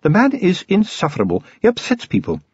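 prim, disapproving clergyman; a stiff churchman complaining. A narrator's reading. Clergyman voiced in a read story.

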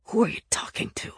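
harsh whispered hiss; sharp, impatient whisper; hissed question. A narrator's reading. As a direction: hiss it under your breath. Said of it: "Nick hissed".

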